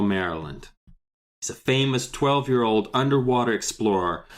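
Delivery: ordinary talk, nothing marked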